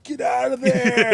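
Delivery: high, loud, drawn-out mock yell; imitated trainer yelling. Teller as Apollo Creed's trainer yelling at Rocky, stretched high shout. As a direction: yell a slow, desperate warning from the corner. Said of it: "like, yelling at Rocky".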